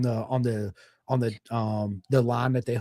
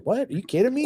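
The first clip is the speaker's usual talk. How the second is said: shocked disbelief; 10 semitones higher, faster than usual. Retold or imitated. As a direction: pitch jumps way up in disbelief. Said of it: "I was like".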